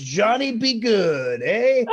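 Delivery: loud, rowdy holler of a catchphrase; mock teasing shout. Barista razzes Johnny with a song title, loud mock cheer. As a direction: imitate a barista razzing a customer.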